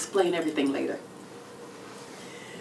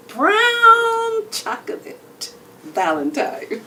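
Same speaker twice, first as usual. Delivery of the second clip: very high, slow and drawn out; sultry pet name; 14 semitones higher, 8 dB louder, much slower than usual. Retold or imitated. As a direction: stretch it out like a sweet nothing. Pet name she planned for him.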